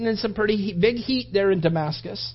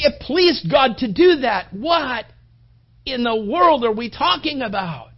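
higher and louder, fervent sermon build; preaching at full force; 7 semitones higher, 8 dB louder than usual. Acted. Pastor pressing the cross on the congregation, fervent preaching. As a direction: preach it out, pleading with the room.

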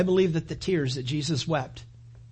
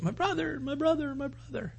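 high, soft grieving repetition of my brother; grieving plea; 11 semitones higher, 6 dB quieter than usual. Retold or imitated. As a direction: plead like a sister in mourning, pitched up. Grieving sister pleads for brother.

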